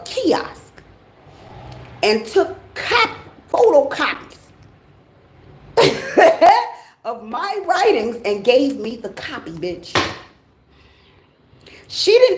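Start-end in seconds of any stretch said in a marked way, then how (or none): 5.8-6.7 loud high laugh at the audacity; burst of laughter. Real, not acted. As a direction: crack up at the memory. Telling the photocopy story.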